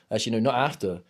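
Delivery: usual speaking voice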